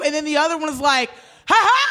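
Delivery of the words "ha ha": high, loud, triumphant "ha ha"; gloating cackle. Retold or imitated. Telling a friend her mixed emotions, gloating. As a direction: crow it with mock-villain glee. Gloating glee at the missed cab.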